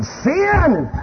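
high, drawn-out craving cry; greedy earth suit begging to sin; the sinful body. Acted. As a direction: whine it like flesh hungry for sin.

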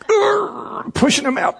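high strained grunt of effort; straining groan. Pretend. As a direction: strain like pushing something out. Tree straining to push out fruit.

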